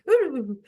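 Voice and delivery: mock impressed ooh. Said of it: "and then they go".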